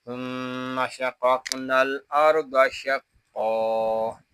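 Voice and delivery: slow recitation with long hum and drawn final vowel; full verse recitation. Shown as a demonstration. Teacher joins the verse up for learners, slow recitation. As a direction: recite the verse slowly and measuredly.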